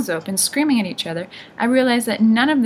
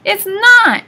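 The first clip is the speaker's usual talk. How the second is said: very high, loud contradiction; shrieked old-woman retort; yelling; 13 semitones higher, 11 dB louder than usual. A narrator's reading. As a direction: yell it back at your sister. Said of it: "yelled the one called Anger".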